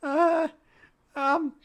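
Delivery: high, stammering nervous gnome; a jittery little gnome squeaking. Acted. Gnome thanks them.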